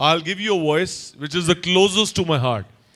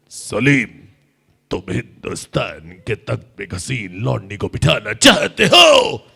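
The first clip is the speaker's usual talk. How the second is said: much lower, booming shout rising at the end; angry emperor; 9 semitones lower, 4 dB louder than usual. Retold or imitated. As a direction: roar at his son in fury. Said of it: "वो गुस्से में बोल रहे हैं". (गुस्से में गरजता बादशाह)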